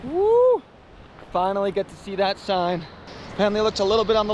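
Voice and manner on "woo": high celebratory "woo"; excited whoop. Real, not acted. Celebrating the drive in, excited whoop.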